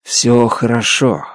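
comforting the alien friend gently. Acted. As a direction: soothe a frightened friend. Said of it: "мягко говорю".

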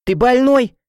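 high, loud, indignant yell; outraged cry. A narrator's reading. Heroine as her friend is punched, shouting. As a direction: cry out in shocked protest. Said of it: "закричала я".

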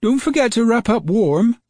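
put-on high, fussy old lady's voice; a grandmother fussing after a boy running out; fussing grandma. A narrator's reading. Grandma calls after James to dress warmly, lightly fussing.

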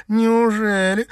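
slow, high-pitched mock wonder; surprised. A narrator's reading. Girl reacting to cousin denying a romance, surprised. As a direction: draw it out in surprise. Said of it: "удивлённо вскинула брови Сима".

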